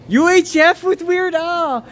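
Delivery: high, slow excited exclamation; getting excited. Real, not acted. Spotting UHF with [name], excited outburst. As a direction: blurt it high, stretching the words with excitement. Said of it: "sorry, I'm getting excited".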